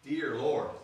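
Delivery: ordinary delivery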